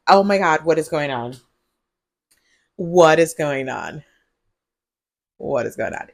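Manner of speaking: ordinary talk, nothing marked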